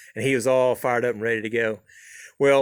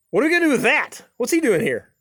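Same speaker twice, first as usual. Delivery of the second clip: high, louder startled protest; much higher, louder indignant buddy voice; startled hunting buddy; 12 semitones higher, 5 dB louder than usual. Retold or imitated. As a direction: squawk it out like a shocked grumpy buddy. Eddie surprised by kid in truck.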